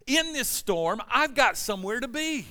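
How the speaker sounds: worked-up, high-pitched exasperated rant; frustrated. Retold or imitated. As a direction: vent frustration at everyone around you, pitched high. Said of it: "And I'm frustrated. Why?".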